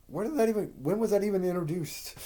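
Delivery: high-pitched, exasperated questioning; incredulous. Retold or imitated. Reviewer questioning a sudden character reveal, incredulous. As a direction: pitch it up in baffled protest. Protests an unexplained character trait.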